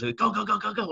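high, rapid-fire mock urgency; urgent urging. Band director sending kid back into class, urgent urging. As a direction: imitate hustling someone through a door.

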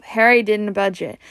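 ordinary delivery, nothing marked

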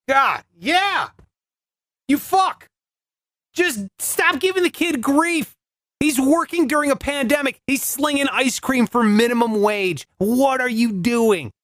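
high-pitched, worked-up telling-off; exasperated scolding. Acted. Storyteller telling off the customer for hassling the kid, exasperated.

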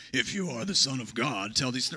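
deep, menacing devil voice; devil's voice. Shown as a demonstration. Pastor to congregation, reading the devil's line in a deep devil voice. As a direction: growl it low like the devil. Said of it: "so it probably sounded more like".

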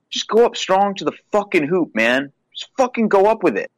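high exasperated urging; frustrated urging. Retold or imitated. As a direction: yell at him to drive to the hoop. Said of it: "where it's like".

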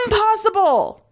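very high, altered commander voice; disbelieving commander. A narrator's reading. Commander rejecting soldier's story, disbelieving. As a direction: exclaim it high in outraged disbelief.

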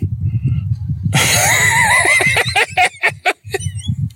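high shrieking laugh that breaks out; loud burst of laughter. Real, not acted. Recalling wife's jab, real shrieking laugh. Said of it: "I lost it laughing".

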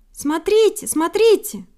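very high, loud bird cry; thrilled, high-pitched exclamation; excited calling. A narrator's reading. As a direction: call friends to look at once. Excited bird calls friends to look.

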